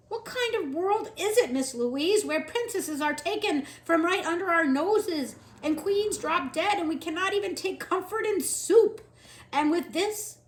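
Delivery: loud, high anguished lament. Acted. Cook lamenting to Louise before crying. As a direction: plead to the heavens.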